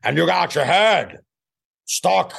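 screaming movie-star impression; Al Pacino. Comedian showing his best line to host, screaming Pacino. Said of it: "I do the screaming Pacino".

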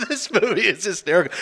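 high-pitched giggle into the words; laughing. Retold or imitated. Reviewer recalling a gory comic scene, laughing. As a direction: say it while cracking up. Cracking up over gruesome scene.

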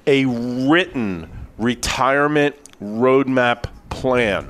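"written" slow and weighty, then each word set apart; emphasis on WRITTEN. Real, not acted. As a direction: spell out "written retirement roadmap plan" slowly, word by word. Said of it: "can't emphasize that word enough".

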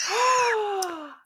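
a high, sharp gasp of surprise; gasp of delight. Real, not acted. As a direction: gasp as if the dice stunned you. GM reacts to roll.

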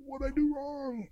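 put-on a kid who doesn't know what he did; meek confusion. Baffled teen after the blowup.

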